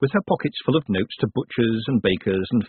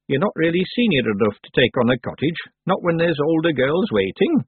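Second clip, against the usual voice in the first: higher, measured witch character; old witch, matter-of-fact; 6 semitones higher than usual. Acted. Old witch advises girl.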